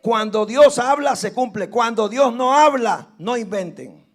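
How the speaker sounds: loud, emphatic pulpit declaration; preaching at full force. Real, not acted. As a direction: thunder it to the congregation. Preaching climax. (predicación a toda voz)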